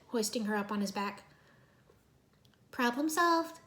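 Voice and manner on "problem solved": smug. A narrator's reading. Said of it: "Irene said smugly".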